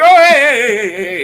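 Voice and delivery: loud, high burst of heys, easing down; hammed-up alarm, starting high and loud; mock panicked surrender. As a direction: frantically call off the fight, playing it for laughs. Imagined panic when hit in fight.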